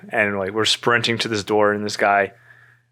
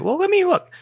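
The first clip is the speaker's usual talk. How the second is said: very high, teasing imitation; flirty helper. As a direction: coo it in a hammed-up high voice. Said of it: "Definitely flirted a little bit".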